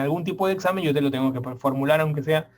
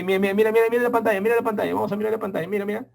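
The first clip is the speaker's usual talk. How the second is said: urgent teacher pointing; 6 semitones higher, much faster than usual. Retold or imitated. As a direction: insist the class look at the screen. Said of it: "Entonces yo te di".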